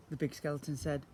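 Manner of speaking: ordinary delivery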